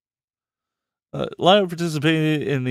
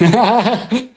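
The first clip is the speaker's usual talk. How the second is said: laughing; 8 semitones higher, 6 dB louder than usual. Real, not acted.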